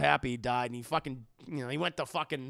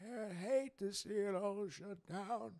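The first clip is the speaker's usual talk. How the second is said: put-on slow, soft, gentle old boss voice; imitating a kindly old boss hiding his evil; kindly old man; 5 semitones higher, 6 dB quieter, much slower than usual. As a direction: coo it like a sweet old company man. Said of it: "he's this kindly old man".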